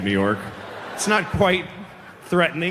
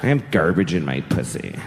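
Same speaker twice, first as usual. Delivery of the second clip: low, laughing mock model voice; mock a vapid model girl; 8 semitones lower than usual. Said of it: "American Apparel girls are like".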